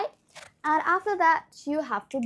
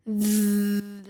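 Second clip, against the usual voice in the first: buzzing bee sound; 5 semitones lower than usual. Shown as a demonstration. Teacher demonstrates voiced th with a buzz. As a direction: hum a steady bee buzz. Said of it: "like a bee".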